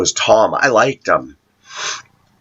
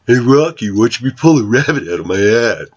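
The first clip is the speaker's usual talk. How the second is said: fast, cartoonish moose voice; Bullwinkle impression; faster than usual. Mocking TV-taught viewers with a quick cartoon moose voice. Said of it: "Anybody remember Bullwinkle?".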